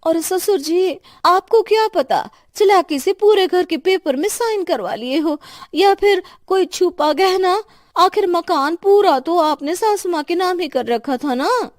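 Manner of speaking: high, loud accusing daughter-in-law; angry accusation. Acted. Daughter-in-law accuses father-in-law of a secret deal, loud and angry. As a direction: hurl the accusation at him.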